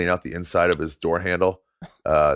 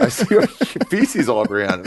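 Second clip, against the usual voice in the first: mock disgusted clerk; 11 semitones higher, 3 dB louder than usual. Hotel clerk refusing, voiced in story.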